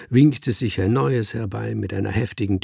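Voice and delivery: plain reading voice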